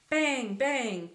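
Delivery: loud, high double bang; voiced gunshots. A narrator's reading. Narrator voicing shots as the boy falls, loud. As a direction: fire the word out twice like shots.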